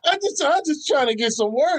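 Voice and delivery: high whiny plea; mock pleading Will. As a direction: play him begging for work.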